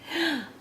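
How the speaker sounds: quick high gasp of delight; excited gasp. Real, not acted. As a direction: gasp as if surprised by the page.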